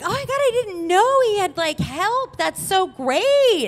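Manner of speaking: high-pitched delighted politeness; gushing ex, imitated. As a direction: mimic the ex's sweet surprise. Ex gushes about the help.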